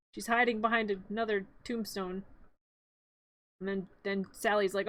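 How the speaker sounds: usual speaking voice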